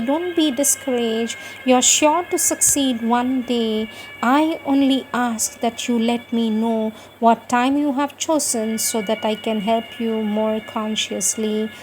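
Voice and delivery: normal talking voice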